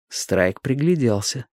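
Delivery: ordinary delivery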